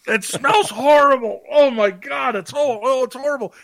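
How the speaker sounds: very high, wailing mock horror; hammed-up revulsion, easing in volume; disgusted outcry. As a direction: cry out as if overcome by a stench. Acts friends' disgust at smell.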